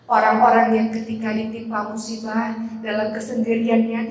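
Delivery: ordinary talk, nothing marked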